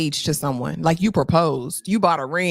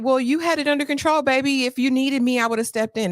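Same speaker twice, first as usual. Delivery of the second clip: mocking the pastor; 7 semitones higher than usual. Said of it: "he said".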